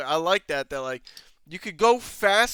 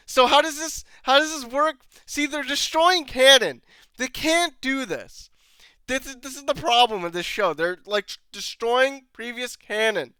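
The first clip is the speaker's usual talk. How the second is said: frustrated rant; 8 semitones higher, 5 dB louder than usual. Real, not acted. Ranting about the plot.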